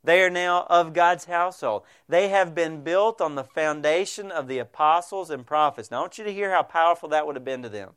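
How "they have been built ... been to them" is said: usual speaking voice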